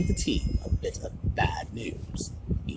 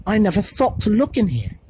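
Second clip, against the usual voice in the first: higher and louder, eager, barely whispered; whispered excitedly; 10 semitones higher, 7 dB louder than usual. A narrator's reading. Hermione to the boys with the old book, excited. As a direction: rush it out with excitement. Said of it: "she whispered excitedly".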